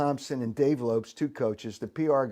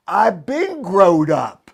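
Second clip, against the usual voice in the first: louder, slow imitation of the manager; slow, loud mock of a gruff manager; 5 semitones higher, 10 dB louder, much slower than usual. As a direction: bark it back slowly like the old skipper. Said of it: "He says".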